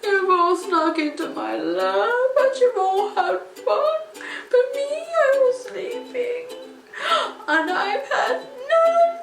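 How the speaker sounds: high, loud blubbering whine; whimpering bear. Acted. Bear complains he missed the party, high blubbering whimper. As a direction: wail it like a sulking crying bear. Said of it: "He whimpers and he moans".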